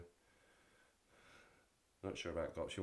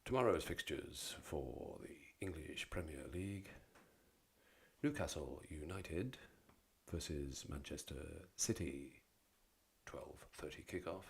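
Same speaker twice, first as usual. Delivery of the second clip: lightly put-on football results reader, lower; fixtures announcer voice; 4 semitones lower, 6 dB quieter than usual. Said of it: "We'll do it in the fixtures voice".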